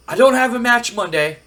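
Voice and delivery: loud, high-pitched acted complaint; indignant wrestler. Acted wrestler complaining to his boss.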